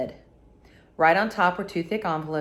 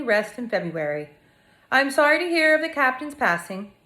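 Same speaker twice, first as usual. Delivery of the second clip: letter read aloud in a higher voice; 4 semitones higher than usual. Acted. Narrator reading a grieving farmer's letter, higher read-aloud voice.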